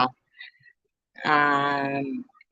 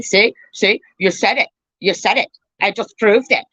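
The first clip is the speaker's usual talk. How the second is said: higher-pitched mock accuser; mocking gotcha; 6 semitones higher than usual. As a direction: pounce in a high, taunting parody. Mocking their clip-and-gotcha habit.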